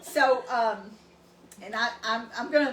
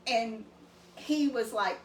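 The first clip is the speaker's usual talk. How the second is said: ordinary delivery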